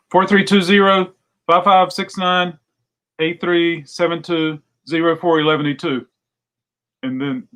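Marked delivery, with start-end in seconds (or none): 0.1-6.1 higher, slower, louder number reading; slow clear readout. Shown as a demonstration. Reading fake card to the scammer, slow and clear. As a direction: read each digit slowly and clearly. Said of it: "you kinda say, like".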